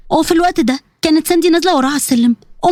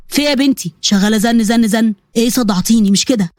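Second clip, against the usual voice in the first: lower, grumbling father's voice; irritated father; 5 semitones lower than usual. Acted. Father to daughter who kept calling, low irritation. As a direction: complain in a lowered, fed-up voice.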